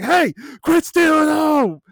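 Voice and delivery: loud, high, drawn-out holler; retold coach's yell. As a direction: yell it across the ball field at your players. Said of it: "I've yelled at them in the game".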